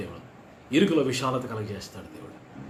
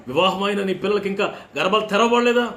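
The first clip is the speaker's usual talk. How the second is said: raised, loud preacher's question; fervent preaching; 9 semitones higher, 8 dB louder than usual. Acted. Pastor calling out to parents, high and loud. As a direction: lift the question high over the hall.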